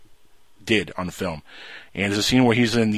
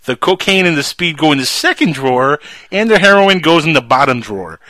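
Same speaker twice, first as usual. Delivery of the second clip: louder deadpan instructions; louder mock-serious kid; sly kid translation; 5 semitones higher, 6 dB louder than usual. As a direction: recite it like serious orders. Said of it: "He says".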